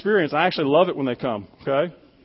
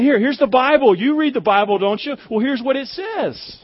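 higher-pitched, lightly imitated door-knocker pushing a point; mock eager proselytizer; 7 semitones higher than usual. Mimicking confident door-to-door proselytizers.

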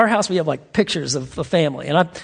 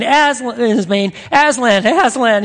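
joyful calling; 5 semitones higher, 4 dB louder than usual. Retold or imitated. Lucy calls lion's name in joy.

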